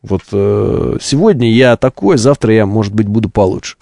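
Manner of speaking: usual speaking voice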